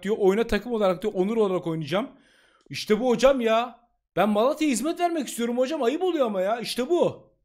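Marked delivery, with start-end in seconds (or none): 2.7-7.3 excited. Real, not acted. Thanking the coach joyfully.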